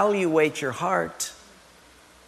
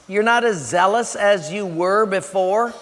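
normal talking voice

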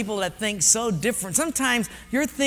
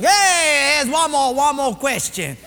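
put-on raised, local-accented quiz boss; personnel guy, insistent; 6 semitones higher, 6 dB louder, slower than usual. Personnel guy puts the next question, loud and insistent. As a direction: pose it loud and high, like a pidgin quizmaster.

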